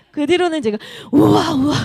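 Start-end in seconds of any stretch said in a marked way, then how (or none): 1.1-1.7 loud, high playful wows for the husband; amazed wows. Retold or imitated. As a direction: show how she cheers him. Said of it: "계속 이렇게 해주거든요". (남편에게 감탄하는 우와)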